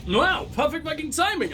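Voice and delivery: high, excited burst of amazement; surprised delight. Real, not acted. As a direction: exclaim at the lucky timing. Game event fires on return.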